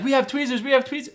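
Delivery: high-pitched mock-urgent offer; excited high imitation of fellow passengers; eager passengers imitated. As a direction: call it out like helpers rushing in. Passengers offer help in retold story.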